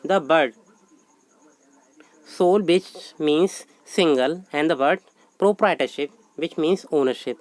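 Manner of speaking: slow, measured dictation of a definition. Acted. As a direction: read it out word by word for notes. Teacher dictates word meaning.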